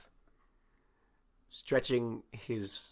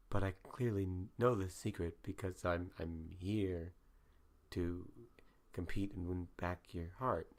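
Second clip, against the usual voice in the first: whispering; 4 semitones lower, 10 dB quieter than usual. Acted. [name] whispers the secret.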